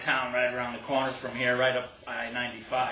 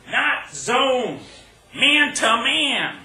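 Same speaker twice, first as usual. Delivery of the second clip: high, raised shout in short bursts; yelled orders; 9 semitones higher, 7 dB louder, much slower than usual. Acted. Player to partner across the floor, yelling orders. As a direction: call it across a roaring court, drawing out the words. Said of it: "yelling orders".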